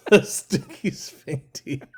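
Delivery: slow laughing mock Aussie accent; giggly put-on Australian accent. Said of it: "Australian accent".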